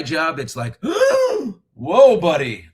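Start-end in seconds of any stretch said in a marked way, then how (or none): 0.8-1.5 loud, high theatrical gasp; hammed-up scandalised gasp; mock gasp. Acts shock at man's dye job. 1.7-2.7 loud, raised pretend astonishment; hammed-up "get a load of you" reaction; mock shock. Mocks reaction to man's dye job.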